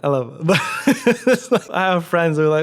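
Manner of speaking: talking through real laughter; laughing. Real, not acted. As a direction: let laughter break up the words. Laughing about dad's accent.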